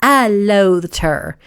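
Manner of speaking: "loathed" stretched out slowly; slow, emphatic "loathed"; drawn-out loathing. A narrator's reading. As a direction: lean long and hard on "loathed". Brooke spits out her hatred.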